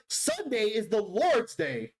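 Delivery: high, slow preachy voice; high slow character voice. Pretend. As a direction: lift the pitch and drawl it. Voicing a game character.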